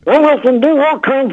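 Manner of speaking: high, squeezed put-on voice; rubber voice on request. Shown as a demonstration. Comedian shows his rubber voice when the host asks. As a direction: let the funny voice take over the words. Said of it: "Can you do that again?".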